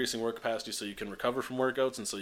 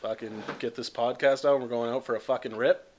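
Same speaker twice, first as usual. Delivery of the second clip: mock Canadian hoser; 6 dB louder than usual.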